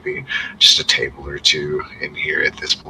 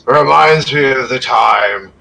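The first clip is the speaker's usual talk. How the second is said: put-on boastful drunk; 9 semitones higher, 7 dB louder than usual. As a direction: crow about past glory, half shouting. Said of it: "he's like".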